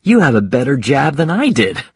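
impressed father exclaiming; excited praise. Acted. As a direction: praise your child with rising excitement. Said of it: "he cried".

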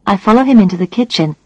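ordinary delivery, nothing marked

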